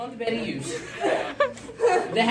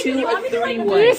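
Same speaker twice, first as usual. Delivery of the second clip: laughing shriek; 13 semitones higher, 4 dB louder than usual. Real, not acted.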